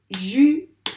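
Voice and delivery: "Jupe" split loudly into ju, pause, pe; clap-along "Jupe" in two separate beats, first one rising; syllable by syllable. Shown as a demonstration. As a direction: sound out "Jupe" as ju... pe. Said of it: "taper les syllabes du mot jupe".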